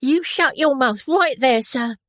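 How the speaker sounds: high, loud scolding washerwoman. A narrator's reading. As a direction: tell off the officer, stick waving. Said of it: "could not imitate her broad Cockney accent".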